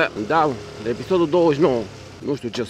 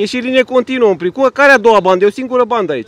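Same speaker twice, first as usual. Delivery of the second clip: loud, high road-rage outburst; furious scolding at full voice; real angry shouting; 8 semitones higher, 7 dB louder than usual. Real, not acted. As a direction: yell accusations at the other driver. Quarrel with a driver over lanes.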